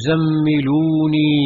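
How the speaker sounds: ordinary delivery, nothing marked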